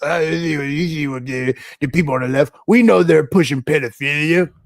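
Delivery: left-right partisan mindset. Acted. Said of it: "you're gonna be thinking like this".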